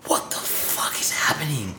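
retold stunned whisper; shocked whisper. Watching men circle pit on fire, shocked whisper. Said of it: "and I'm in shock".

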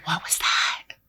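whispered aside. Retold or imitated. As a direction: hush it out like a puzzled aside. Mock reaction to Bo's emphasis.

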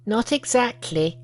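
high, odd little monkey voice. Acted. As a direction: speak as an eager young monkey.

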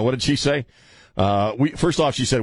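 ordinary talk

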